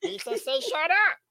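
high goofy creature talking back; squeaky, cartoonish alien retort; Jar Jar Binks. Shown as a demonstration. Creature answering back to a scolding, high goofy voice. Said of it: "Jar Jar, sit your ass down".

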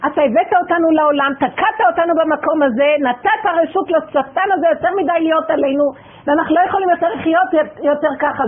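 crying out at God for a suffering family; tearful furious protest. Retold or imitated. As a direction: plead and rage through tears.

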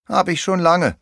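high, weary little pirate; high-pitched pirate complaint; groaning. A narrator's reading. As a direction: moan it in exasperation. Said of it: "stöhnt der kleine Pirat".

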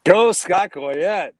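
loud, slow, drawn-out cheer. Real, not acted. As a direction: hype him up. Cheering the speaker on.

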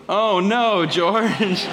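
high, singsong "Oh, no, George"; mock book refrain. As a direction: tease the bad move with the refrain. Mocking a bad husband move.

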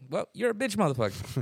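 1 mock call-out. Acted call-out of a hater.

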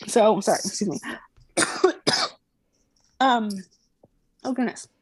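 coughing after water went down wrong; clearing the throat mid-sentence; choking on a drink. Real, not acted. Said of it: "I'm, like, choking".

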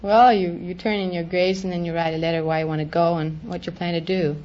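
ordinary delivery

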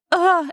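high, wailing mock sob; hammed-up crying wail. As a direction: play her bursting into tears. Imitating her bawling.

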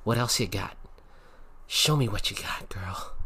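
lightly acted, breathy goading voice; provoking taunt. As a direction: taunt them in a half-whispered mock challenge. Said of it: "it was, like, provoking me".

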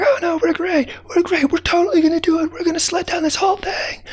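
very high squeaky ant voices calling back; cheerful high ant shout; tiny ants shouting back. Acted. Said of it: "they shouted back".

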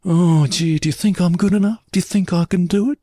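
high-pitched imitation of a needy performer; mock insecure pleading; self-doubting star. Acts star's self-doubt.

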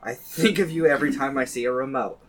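slow, deliberate mock-solemn recital; poem reading. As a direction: read it like a eulogy in verse, slowly. Reads own pet epitaph poem.

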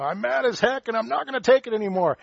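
high, loud hammed-up rant; mock rant. Pastor imitating an angry complainer, loud mock rant. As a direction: rail at the sky in mock fury. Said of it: "screaming at the sky".

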